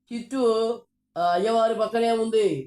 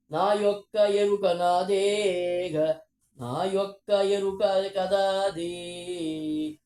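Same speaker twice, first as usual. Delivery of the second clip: slow drawn-out declaration; much slower than usual. Retold or imitated. The goddess solemnly claims awareness, said very slowly. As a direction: stretch each word very slowly, like a solemn chant. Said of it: "అని అమ్మవారు చెప్తున్నారు".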